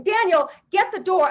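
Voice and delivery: high, loud yell across the house; screaming. Retold or imitated. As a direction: yell for your brother to get the door. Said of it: "I'm screaming".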